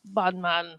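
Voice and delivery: mock English accent.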